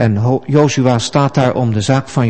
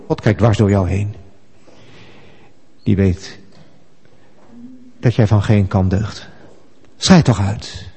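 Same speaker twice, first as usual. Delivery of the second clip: discouraging inner voice; 5 semitones lower, 4 dB quieter than usual. Acted. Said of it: "dat stemmetje dat zegt".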